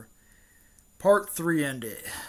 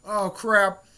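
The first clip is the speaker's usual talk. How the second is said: high, slow, loud mock alarm; kinda frightened; 6 semitones higher, 6 dB louder, much slower than usual. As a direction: play a startled, worried reaction. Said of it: "he was kinda frightened".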